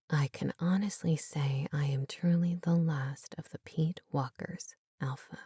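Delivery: hushed, breathy inner voice; whispered thought. A narrator's reading.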